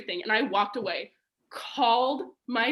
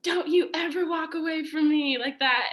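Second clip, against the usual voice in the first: angry father; 6 semitones higher than usual. Retold or imitated. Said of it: "and he was like".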